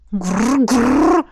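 rolling dragon growl. Acted. Рычанье Рейлик. (драконий рык)

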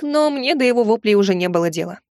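ordinary delivery

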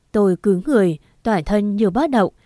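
usual speaking voice

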